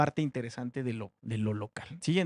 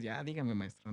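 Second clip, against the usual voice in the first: a mock mumbled student reply, much softer; imitating students; 5 semitones lower, 10 dB quieter than usual. Teacher mimicking his students' soft, listless reply. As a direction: softly parrot the class's lazy answer. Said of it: "Así como ustedes".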